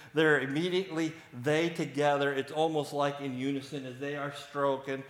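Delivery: ordinary talk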